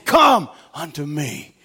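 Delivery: tender quoted scripture; gentle voice of the Lord. Acted. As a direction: call them gently to rest.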